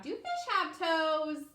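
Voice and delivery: ordinary delivery, nothing marked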